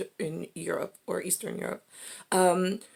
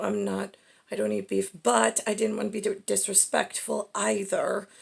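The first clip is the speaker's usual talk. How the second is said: ordinary talk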